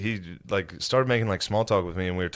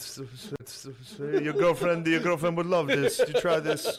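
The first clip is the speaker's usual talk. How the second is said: mall kiosk seller; 7 semitones higher than usual. Retold or imitated. Imitating pushy kiosk tester.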